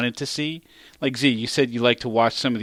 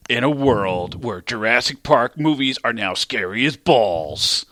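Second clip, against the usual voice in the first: movie trailer voice; slower than usual. Acted. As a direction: announce it like a blockbuster trailer.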